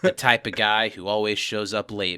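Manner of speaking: quiet noir narration; low-key detective voiceover; noir narrator. Acted. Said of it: "a noir voice starts narrating in my head".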